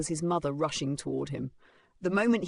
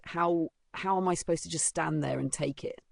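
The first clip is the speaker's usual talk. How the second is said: plain reading voice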